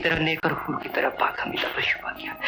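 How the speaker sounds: soft, self-reproachful lament; hushed remorse. Acted. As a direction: sink towards a near-whisper in regret. Remorse after realizing his mistake. (धीमी, पछतावे भरी आवाज़)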